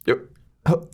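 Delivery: ordinary talk, nothing marked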